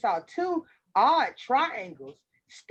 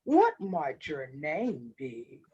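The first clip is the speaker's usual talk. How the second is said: low, smooth wolf voice, stumbling over a slip; hungry wolf covering up with politeness; low and courtly, slowing on the question; sly wolf; 8 semitones lower than usual. A narrator's reading.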